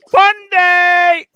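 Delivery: fun day yelled out high and loud; excited shout. Real, not acted. Host hyping his viewers, loud excited shout. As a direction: hype the stream with a big shout.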